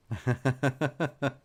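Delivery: high staccato giggle; laughing. Real, not acted. Reader can't do accent, giggles high. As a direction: giggle at your own failing.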